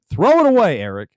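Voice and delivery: acting teacher. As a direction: call it out like an exasperated coach. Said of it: "And the teacher said".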